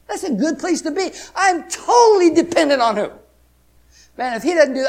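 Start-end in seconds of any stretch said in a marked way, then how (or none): 0.1-1.1 emphatic. Real, not acted. 1.3-3.1 loud, high call to the crowd; preaching shout. Real, not acted. Preacher calling a question out to the congregation, loud.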